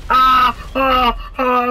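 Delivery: high, loud panicked "ah"s; alarmed cries. Real, not acted. Player being shot down, crying out in alarm. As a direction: yelp repeatedly under fire.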